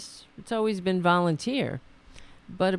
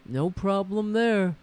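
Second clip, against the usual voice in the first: slow, put-on politician's voice; slow mock boasting; mock debate boast. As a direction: imitate a candidate's drawn-out assurance. Imitating debate boast about size.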